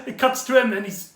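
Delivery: usual speaking voice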